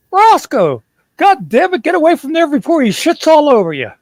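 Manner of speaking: put-on high, loud, furious old man's rant at the dog; angry grandpa. Grandpa sits up and yells angrily at the dog. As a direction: bellow at the dog like a cranky old man.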